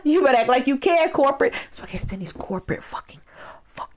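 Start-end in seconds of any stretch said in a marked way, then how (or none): 1.7-3.9 unvoiced whispered rant; whispered cursing. Real, not acted. Vlogger cursing corporate bosses, whispered. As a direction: mutter it under your breath.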